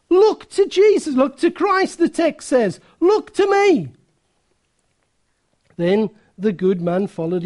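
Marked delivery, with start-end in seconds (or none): 0.1-3.9 high, pressing pulpit exhortation; acted-out urgent preaching; urgent preacher's appeal. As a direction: plead like an impassioned preacher, pitched up high.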